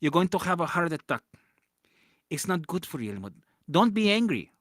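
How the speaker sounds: a hint of a scolding mother; imitating mom. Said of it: "That's what my mom said".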